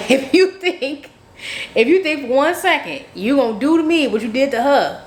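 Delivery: high-pitched, acted-out sassy warning; indignant warning. Woman warns the man not to leave her, indignant. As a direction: pitch it way up, mock-threatening.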